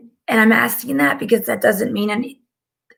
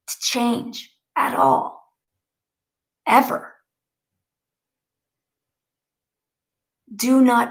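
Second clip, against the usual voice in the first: slow, weighty proclamation with long pauses; channeled message. Acted. Medium relaying Maya Angelou's message to listeners, slow and solemn. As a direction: deliver it like solemn teaching, word by word.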